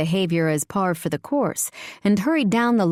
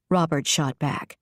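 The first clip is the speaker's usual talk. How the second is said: ordinary delivery, nothing marked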